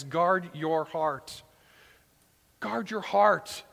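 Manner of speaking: slow, weighted repeats; emphatic repetition. Shown as a demonstration. Preacher repeating the theme, slow and weighty. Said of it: "to use repetition like this".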